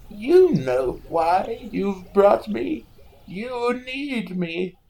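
eerie slow voice. Acted. Faceless seer telling the brother he needs him, slow and eerie. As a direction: let each word creep out like a faceless demon. Rogvir answers Rhaegal, menacing.

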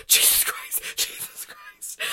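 gasped, voiceless mock horror; panicked whisper. Daughter as the huge butterfly lands on her arm, panicked whisper. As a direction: re-enact breathless terror in a whisper.

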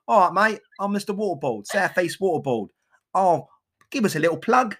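lightly played cheeky water man; Mister Waterboard, cockney. The water company character asking for a plug in a mock London accent. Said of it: "My normal voice".

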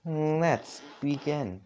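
slow, high synthetic announcer voice; computerized voice. A narrator's reading. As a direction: draw it out like a machine host. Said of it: "the computerized voice shouted".

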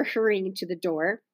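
usual speaking voice

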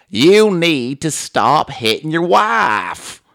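slow, loud, emphatic TV counselor; Doctor Phil. Retold or imitated. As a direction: drag out the obvious advice, loud and weighty. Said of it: "says obvious shit slowly".

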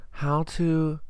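slow, halting words with long gaps; typing aloud. Real, not acted. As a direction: say it piece by piece as you type it into a search. Typing a search while saying it.

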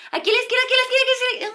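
high, repeated urgent pleading; frantic directions. Retold or imitated. Passenger pointing out the left turn, mock-frantic. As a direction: imitate panic at a missed turn.

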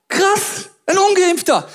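mock shock.

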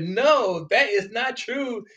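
hammed-up indignant denial, pitched high; mocking denial. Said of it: "compelled to reject this and said".

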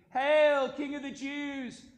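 high shout, slowing after the first word; loud hailing cry. A narrator's reading. Soldiers hail the thorn-crowned prisoner, loud cry. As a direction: hail him loudly, like jeering soldiers.

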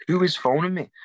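high, loud exasperated question; exasperated at interruption. Real, not acted. Interviewer interrupted by a phone call, exasperated. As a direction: burst out, put out by the interruption.

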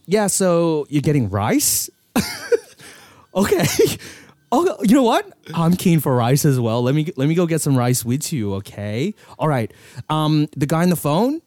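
cheerful Filipino American agent going to lunch; Filipino American accent. Pretend. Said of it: "Like a Filipino American accent".

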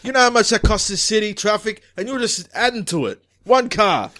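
angry police chief. Acted. Chief berating detective caught in traffic, angry. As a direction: chew out your officers as the chief.

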